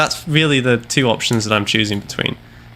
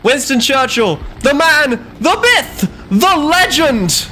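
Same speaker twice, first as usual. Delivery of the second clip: high, loud, drawn-out hero worship; hammed-up rallying cry; 4 dB louder, slower than usual. Gamer hails Churchill before battle, hammed-up epic cry. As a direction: bellow it like an epic movie trailer.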